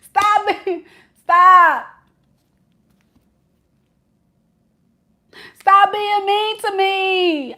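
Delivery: high, slow whine; pleading boyfriend. Retold or imitated. Reader voicing the hurt boyfriend pleading with Shay, high.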